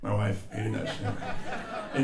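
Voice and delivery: normal talking voice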